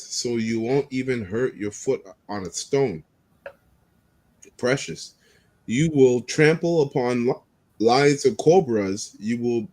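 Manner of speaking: slow, solemn Bible reading; scripture reading. Acted. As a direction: read it like scripture. Reads psalm aloud.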